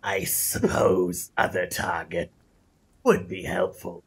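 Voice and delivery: slow, low reptilian voice; lizard folk voice. Acted. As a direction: speak like a wary lizard, slowly. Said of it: "keep doing the lizard folk voice".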